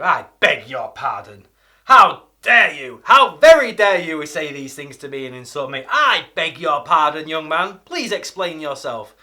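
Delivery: loud, hammed-up indignation; offended outrage. Shown as a demonstration. Scolding a young man who insulted you, loud and indignant. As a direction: thunder it out like an insulted elder. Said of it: "a reply to somebody who's upset you".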